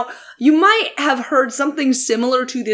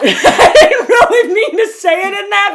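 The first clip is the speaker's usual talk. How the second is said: laughing; 9 semitones higher, 6 dB louder than usual. Real, not acted. Laughing at own voice.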